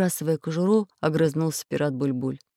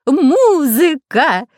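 high, loud, stretched-out cry of delight; elated drawn-out yell; joyful shout; 9 semitones higher, 9 dB louder, much slower than usual. A narrator's reading. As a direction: cry it out dancing with happiness. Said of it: "закричал Дырка".

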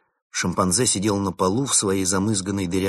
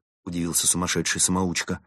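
ordinary delivery

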